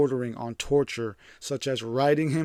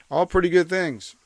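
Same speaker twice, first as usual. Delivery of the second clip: sarcastic; 5 semitones higher, 6 dB louder than usual. Real, not acted. Ironic aside about cult teachings.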